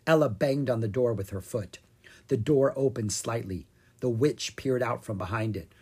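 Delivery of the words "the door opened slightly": usual speaking voice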